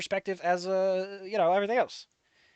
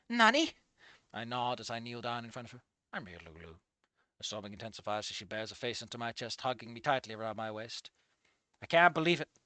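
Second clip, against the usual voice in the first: soft, hushed reading of a tender scene; story narration; 4 semitones lower, 4 dB quieter than usual. A narrator's reading. Reading his viewer's fanfic aloud, soft hushed narration. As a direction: read it low and quiet, like a sad romance.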